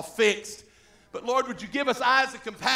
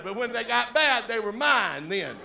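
ordinary delivery

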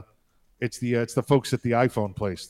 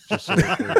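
laughing through it, higher; 7 semitones higher than usual. Real, not acted. Disclaiming the ducking word, laughing. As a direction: chuckle the disclaimer.

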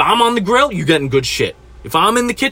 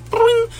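high-pitched imitated tone; mock phone beep. Pretending to send callers to voicemail, high mock beep. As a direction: make a short high machine blip. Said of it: "I'm just going".

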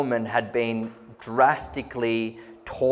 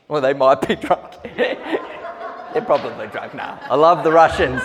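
laughing while talking; 3 dB louder than usual. Real, not acted. Joking about Russians being drunk.

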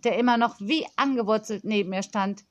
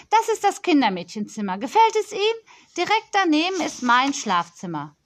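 put-on higher, bossy little girl complaining into the phone; a pampered child scolding a supplier; spoilt girl on phone; 6 semitones higher, 3 dB louder than usual. Spoilt girl scolding the supplier on the phone, higher and bossy.

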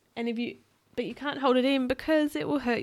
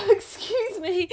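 high, slow giggly apology; laughing; 10 semitones higher, 4 dB louder, slower than usual. Real, not acted. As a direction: laugh through it. Laughing after fart list.

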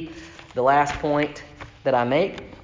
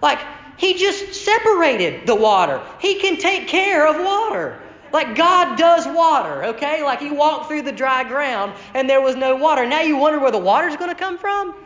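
much higher, incredulous rant; exasperated disbelief; 10 semitones higher than usual. Acted. Preacher mocks the grumbling people, higher and incredulous. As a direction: act baffled that anyone doubts God's water.

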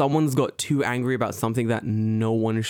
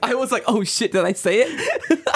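laughing; 11 semitones higher, 4 dB louder than usual. Real, not acted. Laughing at own joke.

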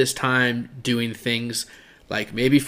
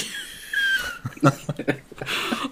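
squealing laugh at a friend; real high-pitched laugh; high burst of laughter; 5 dB quieter than usual. Real, not acted. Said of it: "what are you?".

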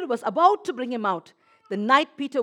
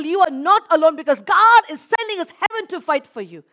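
loud, high, rousing preacher; full-force preaching; 6 semitones higher, 8 dB louder than usual. Acted. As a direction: build it up like a revival preacher.

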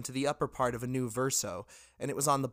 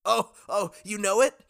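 higher, louder eager showman; hyping crowd; 7 semitones higher, 6 dB louder than usual. Acted. Johnlith on the podium to the crowd, eager and loud. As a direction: pump up the crowd.

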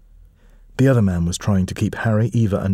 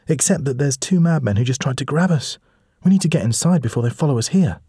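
put-on lightly higher, urgent girl's voice; a brave kid warning her friend to hurry; young girl Edie, worried; 6 semitones higher than usual. Edie urging Felix to get inside, a touch higher and urgent.

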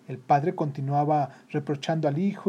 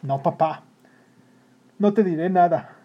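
firm resolve, growing louder; determined; 4 semitones higher, 7 dB louder than usual. A narrator's reading. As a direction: vow it to yourself with decision. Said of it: "se repitió con decisión".

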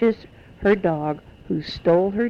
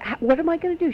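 higher, mock distraught cry; retold despair, a touch hammed-up; imitating a panicked wail; 9 semitones higher, faster than usual. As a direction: act out the mother's panic, lightly. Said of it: "she was so upset".

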